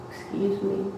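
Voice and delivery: soft, polite excuse me; a soft, polite request for attention; real soft. Retold or imitated. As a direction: say "Excuse me." gently and quietly. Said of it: "Real soft".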